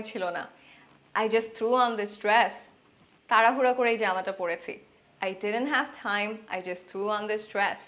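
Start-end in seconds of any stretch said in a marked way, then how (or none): 1.1-2.5 slow model sentence. Shown as a demonstration. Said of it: "ইংরেজিতে বলতে পারি". 5.2-7.8 slow model sentence. Shown as a demonstration. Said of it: "ইংরেজিতে বলতে পারি".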